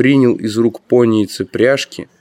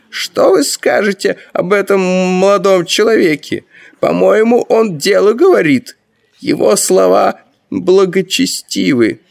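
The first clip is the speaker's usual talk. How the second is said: high-pitched, emphatic ruler's voice; amazed Grand Master; 10 semitones higher, 3 dB louder than usual. A narrator's reading. Grand Master asks Ferl about the young man, amazed. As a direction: speak up eagerly in a high character voice. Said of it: "изумлённо воскликнул верховный магистр".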